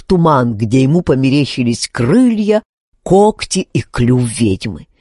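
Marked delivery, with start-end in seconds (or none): none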